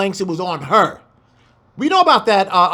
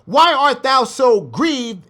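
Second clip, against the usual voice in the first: scripture reading; 5 semitones higher than usual. Acted. Reads scripture aloud.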